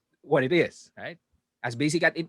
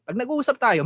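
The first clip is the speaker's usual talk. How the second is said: ordinary delivery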